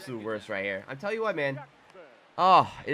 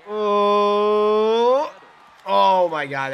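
long rising, loud "oh" of suspense; drawn-out awed outburst; amazed gasping cry; 7 semitones higher, 8 dB louder, much slower than usual. Real, not acted. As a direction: raise the pitch and volume in amazement. Watching a tying play happen.

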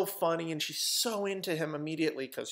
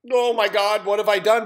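loud, high mock panic; raised-voice dismay at one's own deed; horrified outcry; 6 semitones higher, 11 dB louder than usual. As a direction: cry out in acted horror. Killer's horror at his act.

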